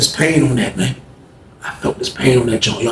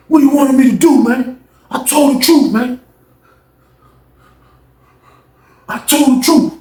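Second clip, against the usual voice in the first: roommate's defensive outburst, pitched higher and louder; heated protest; 10 semitones higher, 4 dB louder than usual. Retold or imitated. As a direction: protest hotly as if wrongly accused.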